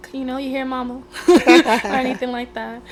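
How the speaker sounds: usual speaking voice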